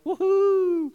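high-pitched whoop; mock celebration. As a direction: cheer in a high, playful squeal. Acting out a good harvest year.

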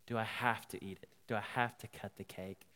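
low, soft, hesitant question; acted-out hushed plea; quiet, timid asking. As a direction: ask small, overwhelmed. Overwhelmed woman asks timidly.

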